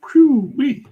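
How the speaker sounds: high, loud whew-wee of admiration; impressed whoop. Real, not acted. Streamer marvels at an artist's discipline, impressed whoop. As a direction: let out a high whistle-like marvel.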